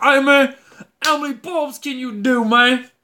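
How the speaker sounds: a hint of a loud Southern recruiter calling out; Southern dude impression. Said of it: "That was my impression of a Southern dude".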